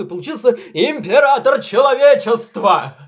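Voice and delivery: loud, triumphant reveal; dramatic exclamation. Acted. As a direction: announce it like an epic twist. Dramatic climax of lore.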